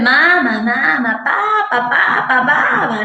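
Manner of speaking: lightly voiced baby babble, mama papa; a hint of a baby repeating syllables; babbling baby syllables. Shown as a demonstration. Therapist demonstrating baby babbling to parents, lightly voiced. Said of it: "por ejemplo, ahí está".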